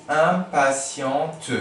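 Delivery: drawn-out "impatiente". Shown as a demonstration. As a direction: say "impatiente" slowly and clearly. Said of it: "spelling demo".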